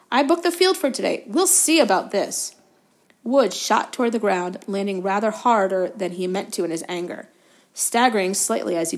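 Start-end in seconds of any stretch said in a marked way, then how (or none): 0.1-2.6 high, indignant protest; outraged. A narrator's reading. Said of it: "in outrage".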